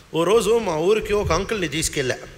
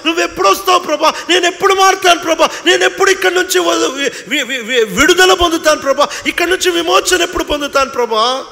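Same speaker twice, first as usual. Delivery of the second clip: higher, louder, half-sung lament to the Lord; desperate singsong plea, quick and rising; anguished prayer; 7 semitones higher, 4 dB louder, faster than usual. Retold or imitated. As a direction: cry out to God, pleading over and over. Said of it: "నీ చేతులు చూసుకున్నప్పుడు".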